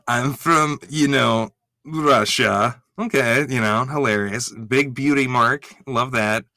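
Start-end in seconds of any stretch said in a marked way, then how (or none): 0.1-2.8 light imitation of a Russian accent; a quick jokey take on a Russian accent; mock Russian accent. Host imitating contestant Madison's Russian character, mock Russian accent. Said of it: "here's Madison as Katiana".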